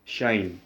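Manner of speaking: "shine", said with an opening sh sound; pronounced "shine". Shown as a demonstration. Teacher introducing the first hyperbolic ratio, "shine" said with sh. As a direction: say "shine" as one syllable, like the verb. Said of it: "This one is pronounced as a shine".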